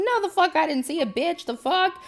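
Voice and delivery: high-pitched, loud, affronted outburst; indignant disbelief. Retold or imitated. Kid answering mom about seeing the witch, indignant. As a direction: squeal it in outraged disbelief.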